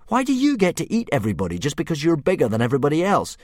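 high, loud and fast; Hiccup protesting. Acted. Hiccup rushing his protest at the dragon, high and fast. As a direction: blurt it out, indignant boy.

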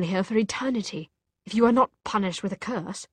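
loud, fast, grave warning; stern warning. Acted. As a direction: lay out the threat hard. Jem warns of Clave punishment.

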